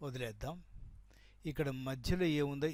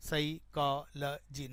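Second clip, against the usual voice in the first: Psychology in four slow, separate beats: sai, kaa, la, jee; syllable by syllable; much slower than usual. Shown as a demonstration. Teacher shows only four sounds are heard, syllable by syllable. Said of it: "నాలుగే వినపడుతున్నాయి".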